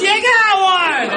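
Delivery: high, slow, hammed-up cheer; imitating a fishing guide's high cheer; mock cheery guide. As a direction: chirp it like a proud guide. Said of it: "and goes".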